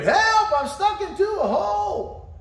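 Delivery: high, loud shout from down a hole; retold high shout for help. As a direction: yell up for someone to help. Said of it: "He's yelling, screaming".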